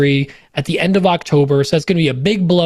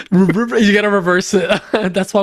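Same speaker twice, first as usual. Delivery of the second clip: laughing. Real, not acted. Laughs at devil joke.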